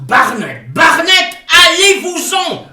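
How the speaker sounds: loud, high furious yell; enraged cry to get out; furious shout. Acted. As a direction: drive him out of the room, screaming. Chasing Barnett away in rage.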